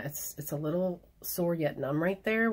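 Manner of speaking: ordinary delivery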